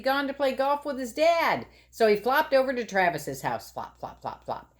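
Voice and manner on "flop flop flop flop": soft flop sounds. Acted. Sound of flopping.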